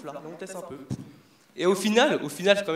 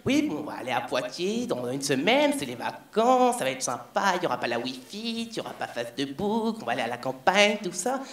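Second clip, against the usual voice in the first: put-on old hairdresser chatter; 2 semitones lower than usual. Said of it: "la vieille, elle fait".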